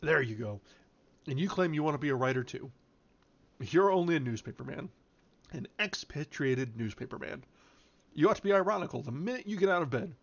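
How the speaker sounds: lightly voiced hectoring pal; teasing friend Bill. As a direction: berate a friend in jest.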